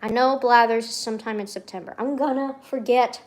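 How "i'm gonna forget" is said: slow, deliberate admission; measured worry. Real, not acted. Streamer worrying about a birthday, measured. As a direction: state it slowly with dread building.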